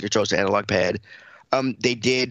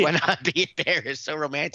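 laughing mockery; 6 semitones higher than usual. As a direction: tease it dreamily through laughter. Mocking romantic Paris.

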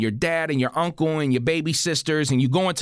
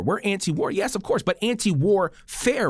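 ordinary talk

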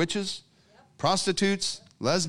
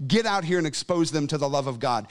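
normal talking voice

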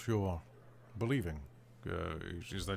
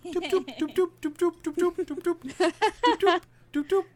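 high, rising doop-doop taps; high doop sound effect; 6 dB louder than usual. Acted. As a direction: tap shoulders with little doops. Sword tapping sound effect.

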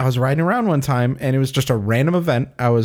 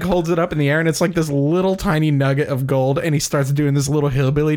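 ordinary delivery

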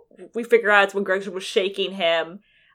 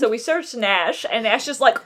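normal talking voice

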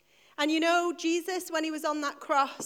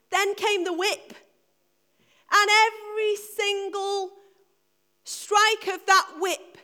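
preaching at full force; 3 semitones higher, 5 dB louder than usual. Acted. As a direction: drive each phrase up to the word "blow". Preaching builds again.

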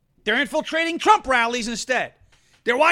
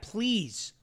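ordinary delivery

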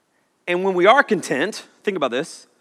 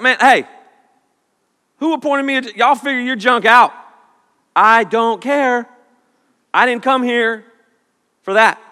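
casual brush-off; 7 semitones higher, 5 dB louder than usual. Retold or imitated. Jesus paraphrased as fed up.

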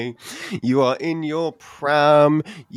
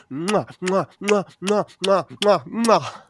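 string of smacking air kisses; blowing an air kiss; 5 semitones higher than usual. Pretend. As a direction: smother the baby in kisses. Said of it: "lift you into my arms".

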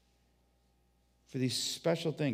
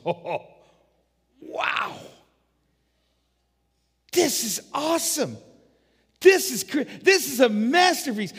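high, loud thrilled exclaiming; delighted awe; 10 semitones higher, 7 dB louder than usual. Acted. Jesus admires his new creation, thrilled and amazed. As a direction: marvel at the finished work.

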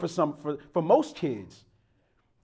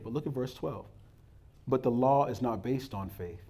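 scripture reading; 5 semitones lower, 5 dB quieter than usual. Acted.

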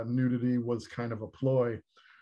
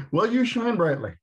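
playful flattery; 7 semitones higher, 4 dB louder than usual. Real, not acted.

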